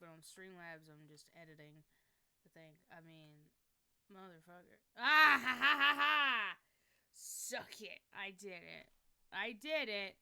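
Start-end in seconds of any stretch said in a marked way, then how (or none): 5.0-6.6 loud, slow victorious laugh; triumphant cackle. Real, not acted. Streamer gloating after fixing the display, loud cackle. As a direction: cackle like you just won. Fix finally worked, triumph.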